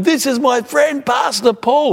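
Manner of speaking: high, proud, drawn-out introduction; mimicked booming enthusiasm, pitched right up; imitating loud talker. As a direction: announce a friend to the whole room. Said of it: "talk at one volume loud".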